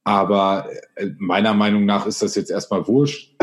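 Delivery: usual speaking voice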